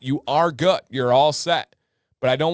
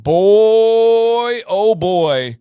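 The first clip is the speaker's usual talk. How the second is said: excited exclaim; 8 semitones higher, 9 dB louder, much slower than usual. Real, not acted. Telling the chat about angry hornets, loud excited exclaim. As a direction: relive the shock.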